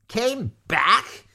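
high, loud, incredulous shriek. A narrator's reading. Maudmont echoing Lavender in disbelief, loud rising shriek. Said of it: "shrieked Maudmont".

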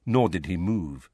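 plain reading voice

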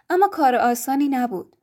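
plain reading voice

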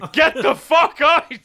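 high, drawn-out mock shout with laughter; outraged churchgoers. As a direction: yell it like a scandalised congregation, half laughing.

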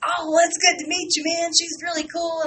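imitated friendly singer. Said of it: "He goes".

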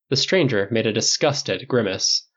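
ordinary delivery, nothing marked

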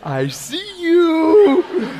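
high, very slow, giggling I see you; delighted peekaboo to God; childlike laughing hello; playful laughing. Retold or imitated. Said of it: "And I go".

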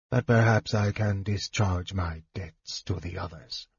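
soft, hushed mage voice; Raistlin. A narrator's reading. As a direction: say it softly, holding the volume back. Raistlin's dialogue.

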